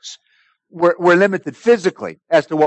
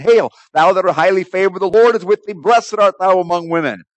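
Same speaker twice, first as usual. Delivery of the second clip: proclaimed greeting; 5 semitones higher than usual. Acted. Angel hailing Mary in scripture, proclaimed aloud. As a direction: ring it out as a raised, sustained greeting.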